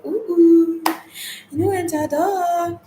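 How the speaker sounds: playful high-pitched hero sound effects; Wonder Woman. Acted. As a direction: lightly mimic a superhero deflecting hits, pitched high. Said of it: "Just like when Wonder Woman".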